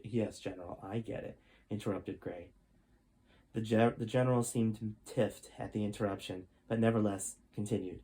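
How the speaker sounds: steady storytelling read-aloud voice; story narration. A narrator's reading. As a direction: tell the chapter like a bedtime story. Reads chapter twelve aloud.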